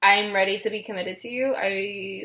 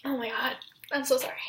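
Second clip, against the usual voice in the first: real, overcome and apologetic; really emotional; 3 semitones higher, 7 dB quieter, faster than usual. Real, not acted. Reader apologising to viewers for tearing up, really emotional. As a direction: apologise softly, moved nearly to tears. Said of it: "This is making me really emotional".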